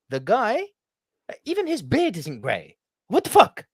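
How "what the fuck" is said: high, loud burst of disbelief; a real what-the-hell yelp; incredulous outburst. Real, not acted. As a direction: blurt it out in shock. Disbelief at ageless actor.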